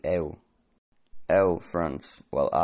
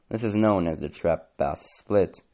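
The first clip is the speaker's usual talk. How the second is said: ordinary talk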